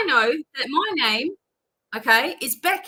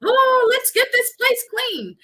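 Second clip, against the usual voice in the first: much higher, slow hammed-up fuss; mock flustered host; 12 semitones higher, 5 dB louder, slower than usual. Reader imitating a client fussing before visitors, high mock panic. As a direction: play the frantic tidier before guests arrive. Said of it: "And you're like".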